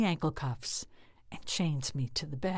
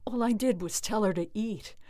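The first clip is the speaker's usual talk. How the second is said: usual speaking voice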